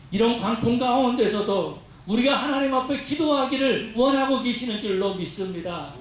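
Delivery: usual speaking voice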